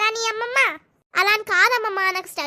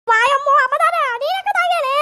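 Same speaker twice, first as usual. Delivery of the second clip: high, louder whiny yelp; outraged grandson; 6 semitones higher, 6 dB louder than usual. Acted. Grandson yelps at grandma that he never drank. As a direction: cry out at the unfair accusation.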